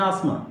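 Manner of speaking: आसमा said as-ma with no t; silent t. Shown as a demonstration. Teacher giving the right आसमा with no t. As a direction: drop the t, say आसमा as as-ma. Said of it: "टी drop किया जाता है".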